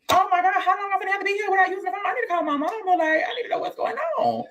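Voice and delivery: high, fast, frantic inner voice; panicked. Retold or imitated. Newly locked-up girl panicking to call her mama, high and fast. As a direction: rush it out in a panic about calling mama.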